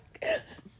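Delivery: high, tearful half-laugh half-sob; a sob breaking through a laugh; crying laugh. Real, not acted. Crying while retelling the call.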